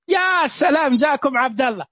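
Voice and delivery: loud, high cheer of delight; teacher cheering a student's right answer, loud and delighted; excited cheer. Real, not acted.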